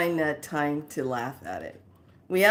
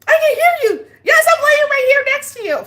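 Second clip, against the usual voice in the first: very high, loud, breaking into laughter; laughing mom; 12 dB louder, faster than usual. Retold or imitated. Mom realising she's alive, high and laughing. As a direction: talk through helpless laughter.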